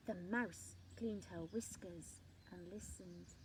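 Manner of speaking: ordinary delivery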